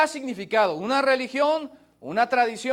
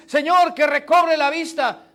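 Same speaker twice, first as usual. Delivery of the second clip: pleading cry; 5 semitones higher, 6 dB louder than usual. Acted. Blind man begging for sight.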